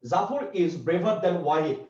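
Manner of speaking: slow and deliberate, word by word; slow dictation. Shown as a demonstration. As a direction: read the example slowly for the class. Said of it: "তার মানে যদি খেয়াল করেন".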